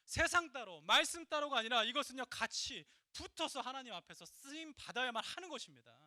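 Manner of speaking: loud, high-pitched exhortation; full-force preaching. Real, not acted. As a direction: drive the point home to the congregation at full voice. (힘차게 외치는 설교)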